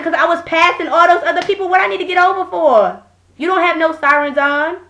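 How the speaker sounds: indignant back-talk. Retold or imitated. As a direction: argue back at the officer in protest. Arguing back at the officer, retold.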